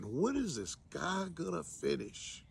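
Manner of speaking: hushed aside in church; soft, impatient complaint; bored grumble. Acted. Bored churchgoer's aside during sermon.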